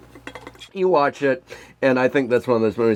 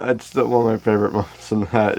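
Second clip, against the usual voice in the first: ordinary delivery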